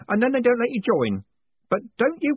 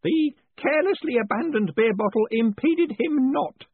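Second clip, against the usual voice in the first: triumphant yell; 5 dB louder than usual. A narrator's reading. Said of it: "Groat yelled triumphantly".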